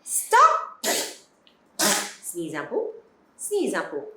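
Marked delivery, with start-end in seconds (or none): 0.0-0.9 sharp high shout to stop. Real, not acted. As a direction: call out to halt someone. Stopping the child.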